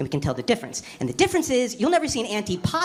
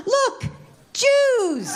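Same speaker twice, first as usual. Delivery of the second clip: gasp, then shout it in wonder; mock tourist excitement; 13 semitones higher than usual. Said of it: "they're like".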